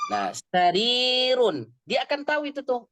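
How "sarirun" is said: sarirun stretched slowly with a long i; dictation voice. Shown as a demonstration. Teacher dictates a word to the class slowly. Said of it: "coba antum tulis".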